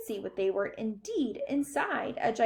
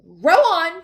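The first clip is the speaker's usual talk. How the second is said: high, very loud holler; shouted command; 8 semitones higher, 12 dB louder than usual. A narrator's reading. Wonka cutting Mike off, shouting to rowers. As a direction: bellow the order to row. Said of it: "shouted Mister Wonka".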